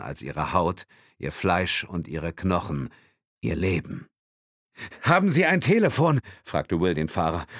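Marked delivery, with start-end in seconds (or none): 5.0-6.2 high, loud, urgent demand; urgent question. Acted. Will asking the driver for a phone, urgently. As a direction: ask urgently over the panic.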